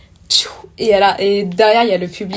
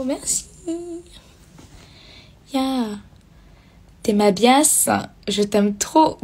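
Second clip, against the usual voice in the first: ordinary delivery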